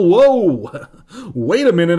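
loud, high startled whoa; frightened outburst. Acted. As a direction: blurt it out in mock alarm. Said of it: "I'm so frightened".

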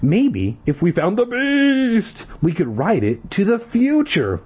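high, drawn-out storybook drama; theatrical suggestion; hammed-up suggestion. A narrator's reading. Hammed-up suggestion about the beast.

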